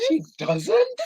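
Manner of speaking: high-pitched laughing echo; incredulous squeal breaking into laughter; laughing disbelief. Real, not acted. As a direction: echo it in mock shock, laughing. Echoes her gasp, laughing.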